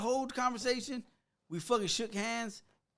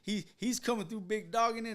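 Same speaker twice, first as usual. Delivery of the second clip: ordinary delivery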